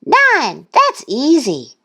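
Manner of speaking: high, triumphant shout. A narrator's reading. Thistle answering her own quiz, high shout. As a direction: shout it like a show-off duckling. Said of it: "Thistle shouted".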